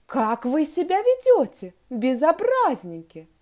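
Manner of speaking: loud, high scolding; angry teacher. A narrator's reading. Teacher to two tickling pupils, angry and loud. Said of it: "рассердилась Глафира Петровна".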